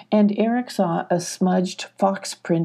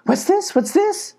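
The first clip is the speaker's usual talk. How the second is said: very high, questioning goat voice; startled goat; 12 semitones higher than usual. Acted. Goat seeing Sylvie crawl out, very high and startled. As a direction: squeak the question twice in alarm.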